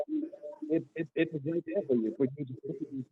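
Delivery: warbling, breaking-up phone line; wobbly warbling call audio. Real, not acted. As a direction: voice wobbling over bad connection. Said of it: "your voice is warbling".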